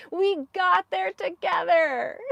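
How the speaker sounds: high, drawn-out cheer through laughter; laughing triumph. Real, not acted. Knitter thanking viewers who helped her, laughing mock-triumphant cheer.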